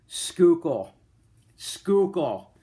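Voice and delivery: Schuylkill said as skoo-kull; two beats, Schuylkill like skoo-kul; local pronunciation. Shown as a demonstration. Philly native saying Schuylkill the local way. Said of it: "Some people call it Schuylkill".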